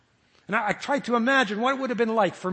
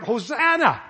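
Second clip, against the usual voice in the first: loud, raised cry of Hosanna; 4 semitones higher, 7 dB louder, slower than usual. Pretend. Jesus crying out as the priests threaten, loud plea. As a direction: call out the prayer loudly. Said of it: "now I'm crying out".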